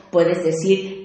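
ordinary talk

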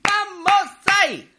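high excited cry, slowing down; triumphant shout. Real, not acted. Host celebrating his pet word with a high shout. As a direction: cheer like scoring a goal.

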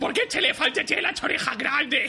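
high, lisping, raspy comic impression; Danny DeVito. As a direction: do a gravelly little tough guy. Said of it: "Danny DeVito hablando así".

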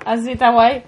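ordinary talk, nothing marked

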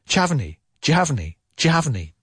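"D'you have any?" as "jav-any", squashed fast; said quickly. Shown as a demonstration. Teacher models the fast connected version, squashed together. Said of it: "If I say it quickly".

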